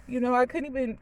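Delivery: choked up, struggling to get it out; crying. Real, not acted. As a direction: push on through real tears. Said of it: "I was not gonna cry".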